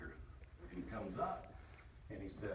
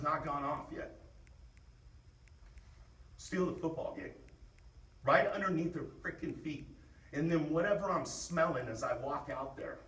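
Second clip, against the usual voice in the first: pep talk; 9 semitones higher, 7 dB louder than usual. Real, not acted. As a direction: push them to want it. Pep talk calming then rising.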